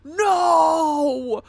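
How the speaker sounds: loud, rising cries of No!; anguished shout. Real, not acted. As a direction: wail No! in dismay. Dismayed at villain's voice.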